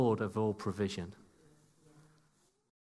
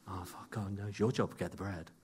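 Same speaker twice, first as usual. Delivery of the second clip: panicked mutter; 5 semitones lower, 8 dB quieter than usual. Retold or imitated. Panicking disciples voiced.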